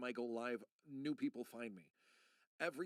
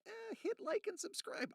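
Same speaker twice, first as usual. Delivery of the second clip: much higher, squeaky mock YouTuber voice; mock YouTuber plea. Mocking his son's videos, squeaky YouTuber voice. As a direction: parody the cheesy channel sign-off. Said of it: "they're always like".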